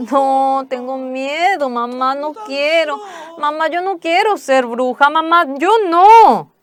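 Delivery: high, loud terrified begging; frightened pleading. Retold or imitated. Mison afraid of becoming a witch, high loud panicked begging. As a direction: beg your mother in panic. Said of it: "Mison se asustó".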